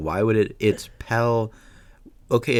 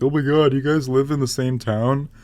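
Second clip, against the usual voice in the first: higher, louder imitation of excited fans; mock amazement; 5 semitones higher, 4 dB louder than usual. Fans asking whether friends share a town, mock amazement. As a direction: lightly mimic people gushing. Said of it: "People are always like".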